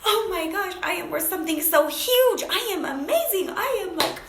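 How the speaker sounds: high, elated, laughing outburst; exhilarated. Retold or imitated. Woman calls her coach about her huge worth, elated and high-pitched. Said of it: "like, exhilarating".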